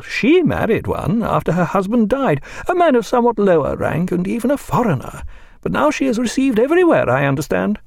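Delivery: put-on high, chattering elderly lady; Mrs Williams voice. A narrator's reading. Narrator voicing Mrs Williams, high and talkative. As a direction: pitch her well above the narration.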